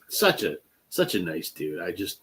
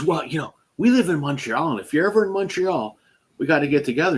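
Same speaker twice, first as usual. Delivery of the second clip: higher, eager mock voice of the wife; imitating the wife; 6 semitones higher than usual. Wife eagerly invites the singer to Montreal, lightly voiced higher. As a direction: lift into her excited invitation.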